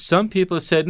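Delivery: usual speaking voice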